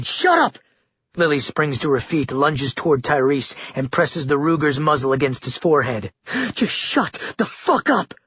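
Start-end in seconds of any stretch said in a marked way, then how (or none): 0.0-0.6 very high furious shout. Acted. Lily screaming at the prisoner to stop. 6.5-8.1 high, slow, bitten-off threat; furious. Acted. Lily threatening the prisoner at gunpoint, slow and furious. Gun pressed to his forehead.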